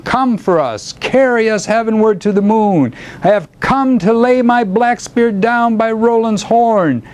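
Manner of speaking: high, rolling bardic recitation; poetry declamation. A narrator's reading. Old poet grandly announcing the summons to friends, declaimed. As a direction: declaim it grandly, then settle back down. Reads own epic poem aloud.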